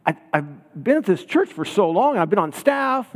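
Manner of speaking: flustered, climbing disbelief; incredulous protest. Retold or imitated. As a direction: pitch climbing as she protests. Emily protesting her church experience.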